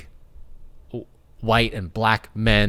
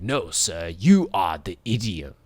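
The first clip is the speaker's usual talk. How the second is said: put-on offended French accent; 2 semitones lower than usual. Offended French guy misreading idiom as idiot, mock French accent. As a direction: play an affronted French detective, accent and all. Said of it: "and the French guy's offended".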